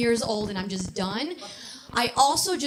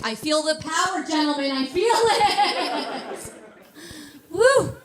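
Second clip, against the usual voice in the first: mock power trip; 5 semitones higher, 4 dB louder than usual. Comic lords body hair over the gentlemen, mock triumphant. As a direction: revel in it, rising to a peak.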